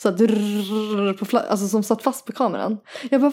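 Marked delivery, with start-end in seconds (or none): 0.2-1.1 rolled, drawn-out fluttering trill; imitated flutter. Retelling the boat mishap to co-host, imitated flutter. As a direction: imitate something flapping on a camera. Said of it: "så var det någonting som fladdrade".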